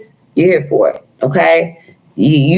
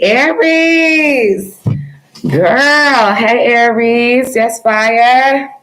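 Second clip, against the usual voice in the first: excited shout-out; 7 semitones higher, much slower than usual. Real, not acted. Hyped greeting of chat members.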